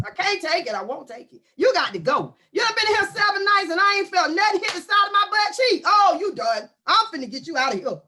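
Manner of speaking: usual speaking voice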